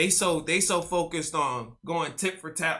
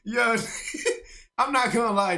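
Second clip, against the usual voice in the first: ordinary talk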